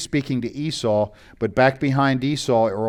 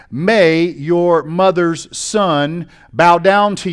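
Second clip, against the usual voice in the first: slow and louder, leaning on every word; a preacher pressing the irony home; emphatic slow stress; 4 semitones higher, 5 dB louder, much slower than usual. Acted. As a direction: drag out "May your mother's son bow down to" to drive the point home. Preacher stressing the key blessing line.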